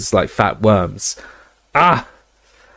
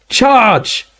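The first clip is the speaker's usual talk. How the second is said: high battle scream; 13 semitones higher, 3 dB louder than usual. Acted. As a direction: scream the charge. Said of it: "they heard her scream".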